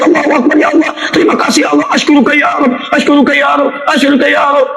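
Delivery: high excited shouts of thanks; ecstatic cries. Retold or imitated. Healed pilgrim thanking God, high excited shouts. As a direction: cry thanks to God, overjoyed. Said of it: "Dia teriak".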